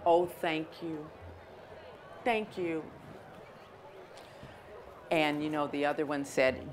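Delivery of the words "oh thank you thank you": softer, slow, worn-out thanks; humbled, exhausted gratitude; wet, tired ladybug humbly thanking her host, slow; tired thank you. Acted. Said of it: "the wet, tired, hungry, grouchy ladybug said".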